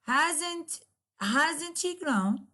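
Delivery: slow, high, falling on grown; drawn-out exclamation, tune dropping at the end; exclamatory falling tune. Shown as a demonstration. Teacher demonstrates an exclamatory question, falling tune. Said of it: "there will be a falling instead of rising intonation".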